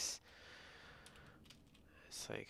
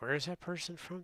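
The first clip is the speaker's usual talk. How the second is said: higher, wondering question; retold curiosity, pitched up high; curious question; 8 semitones higher than usual. Asking in the service about someone's origin, curious question.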